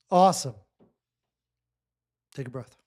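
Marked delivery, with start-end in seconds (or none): 2.3-2.7 much softer, lower, gentle cue; soft breathing cue. Real, not acted. Coach guiding a client into a breath, soft and low. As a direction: drop the voice to a soft invitation.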